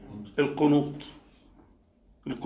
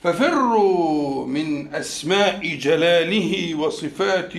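slow, deliberate, weighing each name; slow explaining; slower than usual. Shown as a demonstration. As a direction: spell it out slowly for the class. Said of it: "يفر من إلى". (شرح بطيء متأنٍّ)

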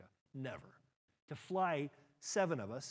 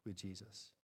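softer, dropping echo of with Jesus; 7 semitones lower, 9 dB quieter than usual. Shown as a demonstration. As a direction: let with Jesus fall away quietly. Repeated phrase, softer echo.

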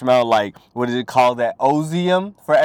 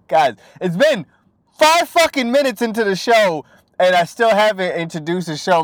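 hype show intro; 7 semitones higher, 6 dB louder than usual. Acted. Hyped show opener.

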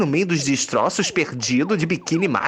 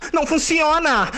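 panicked cry; 10 semitones higher than usual. Acted.

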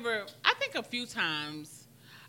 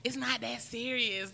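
slow, dismissive imitation of the girl; mocking the girl; 3 semitones higher, 5 dB quieter, slower than usual. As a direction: drag it out like she's brushing it off. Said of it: "she was like".

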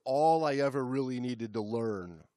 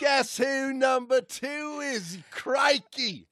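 slow, higher, loud Aussie bellow; mock Australian shout; 12 semitones higher, 7 dB louder, slower than usual. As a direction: shout it like a cartoon croc hunter. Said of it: "with our accents".

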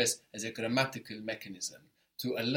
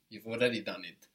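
normal talking voice

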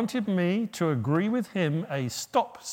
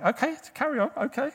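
doctor; 9 semitones higher, faster than usual. Retold or imitated. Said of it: "he kept stopping saying".

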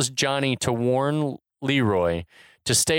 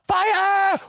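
very high, hammed-up scream; imitated scream; 4 dB louder than usual. Host imitating Eddie obsessed with the video, high mock scream. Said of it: "Eddie screams out".

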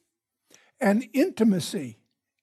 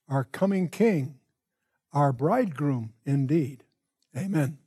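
quiet, unhurried prayer voice, a little softer than his teaching; 4 semitones lower, 3 dB quieter than usual. Acted. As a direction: pray earnestly to the Father before the lesson. Opening prayer before the study.

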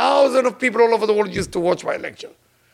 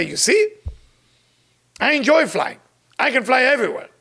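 higher, mock-indignant boasting; mildly argumentative husband; 6 semitones higher than usual. Husband argues about his wife's flying fear, mildly indignant. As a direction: retell a husband scoring a point, pitched up. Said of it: "They start fighting again".

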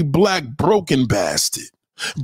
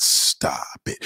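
whisper; 6 dB quieter than usual. Acted. Mocking whispered dismissal.

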